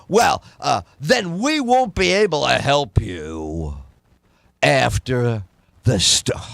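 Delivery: mock-solemn TV anchor scolding viewers; ominous weather anchor. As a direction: lean in and lecture like a grave weatherman. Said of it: "They lean in and they go".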